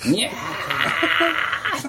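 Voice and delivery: 長く引き伸ばした猫の鳴きまね; drawn-out cat yowl. Retold or imitated. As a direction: stretch the cat call out like it echoes off the hills. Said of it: "山彦ぐらいなんか、帰ってくる".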